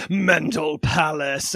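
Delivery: slow, ominous title voice; mock scary. Host contrasting the American commercial's title, mock-ominous. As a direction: draw out the spooky name menacingly. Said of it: "big scary critters".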